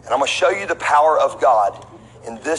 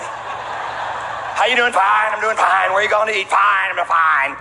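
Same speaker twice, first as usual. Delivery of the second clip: mock breezy small talk; 3 semitones higher, slower than usual.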